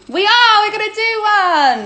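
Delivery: loud, high burst of excitement; we are doing it, so excited. Real, not acted. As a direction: shout it out, thrilled. Excited announcement.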